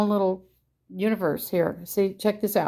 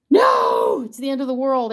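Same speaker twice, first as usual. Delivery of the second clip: mock panic; 4 semitones higher, 5 dB louder than usual. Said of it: "Everybody freaks out".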